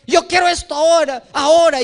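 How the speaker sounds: put-on high, whiny child voice; whiny child demanding. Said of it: "un niñito cuando va al mercado y quiere un juguete".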